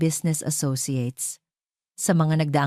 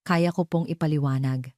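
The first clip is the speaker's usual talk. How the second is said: usual speaking voice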